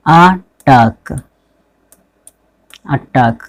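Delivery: "अटैक" slowly as aa-TAAK, with an "a" vowel; "अटैक" ending in -tak, spaced out for learners; drawn-out taught pronunciation. Shown as a demonstration. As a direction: model "अटैक" as अटाक, syllable by syllable. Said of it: "क्या बोलना है".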